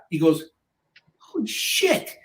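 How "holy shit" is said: very high, softer gasp of awe; imitating a stunned discovery; retold astonishment. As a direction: let it out high and hushed, floored. Retold guy hears the earplug.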